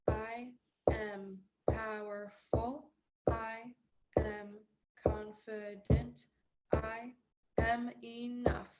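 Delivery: slow, deliberate affirmations; slow mantra. Shown as a demonstration. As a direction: say each word slowly and firmly. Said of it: "I'll say it first".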